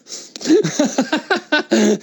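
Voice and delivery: high-pitched burst of laughter; real laugh. Real, not acted. Laughs at his own accent story.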